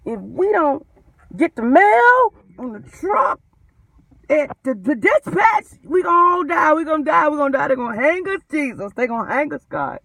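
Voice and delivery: hammed-up dread of getting hanged; frantic runaway slave. Mock runaway slave panicking over the mail truck. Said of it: "this is like a runaway slave".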